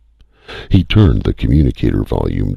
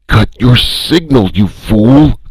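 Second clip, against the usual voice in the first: higher angry shout; strident yell; 13 semitones higher, 5 dB louder than usual. A narrator's reading. First voice yelling at Calhoun, strident. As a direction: snarl the order.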